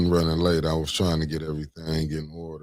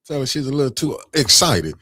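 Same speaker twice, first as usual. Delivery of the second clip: imitating the callers; 9 semitones higher, 4 dB louder than usual. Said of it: "They said".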